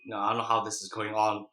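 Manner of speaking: ordinary talk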